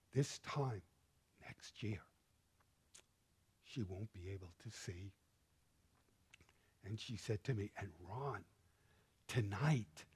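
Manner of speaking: low, very soft, moved retelling; hushed and real, with heavy pauses; close to tears. Real, not acted. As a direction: tell it quietly, holding back tears. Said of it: "you're gonna make me cry".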